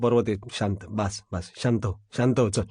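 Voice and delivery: low, soft hushing; calming down. Acted. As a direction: quietly settle a tearful subordinate. Boss calms down tearful subordinate. (खालच्या आवाजात शांत करत)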